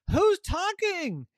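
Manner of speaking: high, drawn-out suspicious question; startled demand. Acted. Sister catches bed talking.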